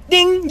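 high, loud chime imitation; mock ding. Streamer voicing the block button, a high loud ding. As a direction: sing out a bell ding.

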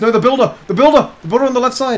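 urgent yelling, pitch way up; frantic shouting. Real, not acted. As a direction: yell a panicked warning. Frantic shouting at caster's screen.

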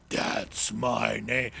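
slow, demonic villain imitation; evil villain voice. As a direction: drawl it like a demonic game villain. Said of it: "He's got an evil voice".

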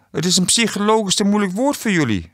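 high-pitched mocking amusement; amused, laughing. A narrator's reading. Quiz host laughing at the losing contestants, amused and high. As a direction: say it as if laughing at them, pitched well up. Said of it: "lachte Astrid".

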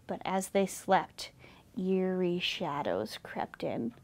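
hushed, spooky storytelling; eerie hush. A narrator's reading. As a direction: whisper it as if danger creeps closer. Suspense as shadows creep in.

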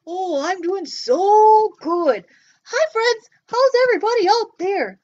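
a bouncy little puppet popping up. Pretend. Puppet Joe talks.

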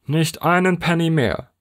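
high, firm and final; firm answer. A narrator's reading. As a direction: shut the deal down firmly. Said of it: "lautete die feste Antwort".

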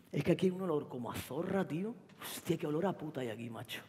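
put-on boyfriend sneering. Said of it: "novio Antonio insultando".

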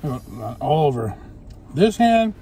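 eating burger between words; talking while chewing. Real, not acted. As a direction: mouth partly full of food. Seen 2: lips pursed, jaw working after bite; holds burger throughout.

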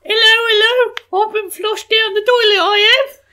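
squeaky, loud cockney shout; hammed-up high cockney British accent; Tom Holland British. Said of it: "Tom Holland British".

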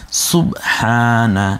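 সুবহানা slow with a clear throaty h; correct pronunciation. Shown as a demonstration. Teacher models proper dhikr recitation, slow and careful. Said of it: "এভাবে উচ্চারণ করুন".

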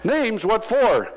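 hint of alarmed nurse; surprised nurse. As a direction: nurse reacting to the list, mock alarm. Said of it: "Of names".

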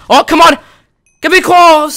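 real high, loud outburst of frustration; frustrated shout. Real, not acted. Gamer shouting at his crate keys to land close, frustrated shout. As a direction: cry out at a near miss. Near miss on crate draw.

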